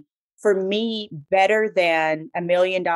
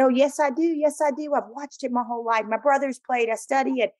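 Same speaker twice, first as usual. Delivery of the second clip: higher-pitched, insistent comeback; indignant retort; 6 semitones higher than usual. Acted. Sportscaster answering the doubting caller, indignant retort. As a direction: protest point by point, pitched up.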